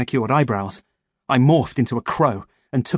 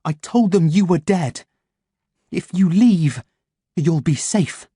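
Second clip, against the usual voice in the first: urgent plea; 6 semitones higher, 3 dB louder, slower than usual. Acted. Pleading with crows.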